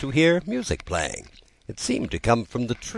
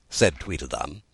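ordinary delivery

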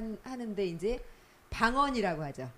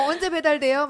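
loud, high mock delivery complaint; playfully hassle them about a late delivery; impatient customer; 6 semitones higher, 8 dB louder than usual. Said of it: "십 분을 못 기다려요". (배달을 재촉하는 손님 흉내)

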